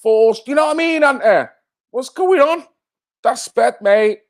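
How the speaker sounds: high, lightly affronted protest; mock outrage. Streamer protesting to chat about being called forced, mock outraged.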